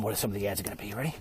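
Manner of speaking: ordinary delivery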